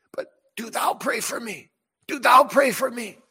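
pleading cry that swells; pleading prayer. Retold or imitated. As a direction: build the plea to a cry. Imitating desperate prayer, building.

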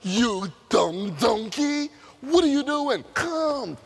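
Balaam yelling at his donkey, hammed-up; angry Balaam. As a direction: scold the donkey with mild mock anger. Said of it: "starts beating his dog".

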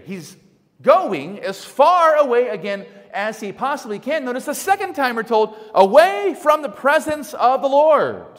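high, loud sermon delivery; forceful preaching. Real, not acted. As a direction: drive it home from the pulpit.

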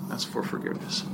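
whispered, almost no voice; hushed repeat. Shown as a demonstration. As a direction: drop to a near whisper for emphasis.

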